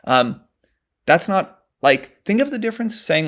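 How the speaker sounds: ordinary talk, nothing marked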